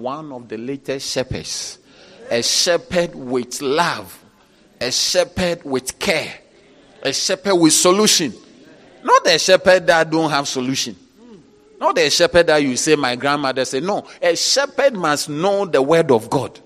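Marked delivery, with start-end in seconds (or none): 2.3-8.3 slow, punched refrain growing louder; rising preacher's cadence; building refrain. Acted. 9.0-16.6 loud, emphatic declaiming; full-force sermon cry; preaching at full force. Acted.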